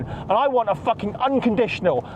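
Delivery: ordinary delivery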